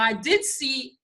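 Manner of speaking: usual speaking voice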